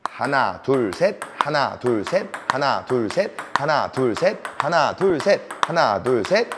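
even, looping three-count; rhythmic counting. Shown as a demonstration. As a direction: keep a steady beat as you count. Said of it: "제가 한번 다시 세볼게요".

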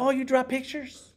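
high mocking voice, patronizing; condescending mockery. A dismissive stranger mocking an artist, high and condescending. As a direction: talk down like to a child.

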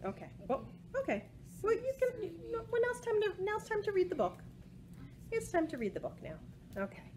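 ordinary delivery

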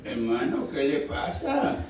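put-on hushed retelling of an old lady; old woman's question. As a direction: ask it slowly and gently, lifting at the end. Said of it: "Dijo aquella anciana".

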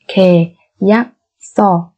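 slow, separated syllables for learners; careful teacher's slow model. Shown as a demonstration. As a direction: spell it out slowly, beat by beat.

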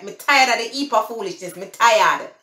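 quick Jamaican patois, punched at the end. Shown as a demonstration. Vlogger repeating phrase three for viewers, Jamaican patois. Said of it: "I'm gonna speak the Patois".

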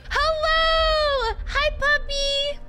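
very high, drawn-out call to a puppy; excited puppy greeting. Real, not acted. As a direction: squeal hello to a little dog. Calling to the puppy.

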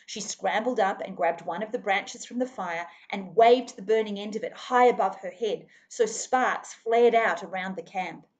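plain reading voice

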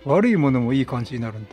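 ordinary talk, nothing marked

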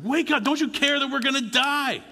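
high, loud, frantic cry for help; panicked yell. Retold or imitated. Terrified disciples waking Jesus in the storm, high panicked yell. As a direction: shriek it like sailors sure they'll drown.

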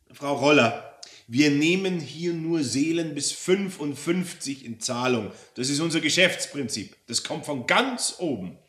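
insistent bureaucrat devil; officious devil. Acted. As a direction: lay down company policy firmly.

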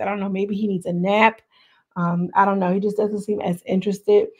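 ordinary delivery

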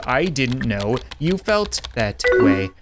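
word by word, evenly spaced; slow, deliberate. Acted. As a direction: spell it out slowly for her. Same answer repeated deliberately.

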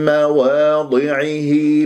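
مواضعه slow, with the م merged with nasal sound; tajweed model; correct recitation. Shown as a demonstration. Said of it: "بهذه الطريقة".

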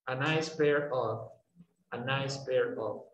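slow and careful, stress on pair; slow drill. Shown as a demonstration. Teacher drilling the phrase slowly for students. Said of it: "la diferencia aquí va a ser nada más".

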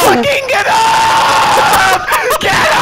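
panicking gamer. Real, not acted. Downed teammate, frantic screaming.

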